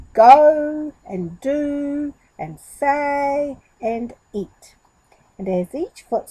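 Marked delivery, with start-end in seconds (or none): 0.1-4.8 very slow, drawn-out recital; reciting. Acted. Goat reciting the rules to himself, slowly. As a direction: chant the rules to yourself.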